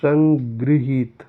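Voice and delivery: संगृहीत said slowly with every syllable clear; slow dictation of संगृहीत; careful correct form. Shown as a demonstration. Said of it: "उनको सही करना है".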